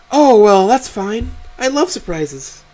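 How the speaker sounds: very high-pitched, cheerful mother voice; pleased mother. Acted. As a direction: accept it gladly, easing off after the start. Voices mother happily accepting the answer.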